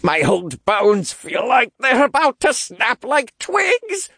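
put-on slow, high-pitched, straining old voice; panting old man. A narrator's reading. Old cultist straining under the weight, panting. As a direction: groan it out with great effort. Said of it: "Oliver panted".

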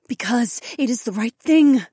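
high, pleading female voice; begging. A narrator's reading. Sister begs fighter to spare the mage, pleading. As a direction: plead earnestly, pitched high. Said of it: "Nokomis begged".

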